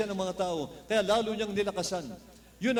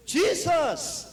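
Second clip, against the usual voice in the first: high, loud call of the name; crying out; 8 semitones higher, 5 dB louder than usual. Retold or imitated. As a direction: call out Jesus across a crowd. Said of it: "Nagsimula siyang sumigaw ng malakas". (malakas at mataas na sigaw)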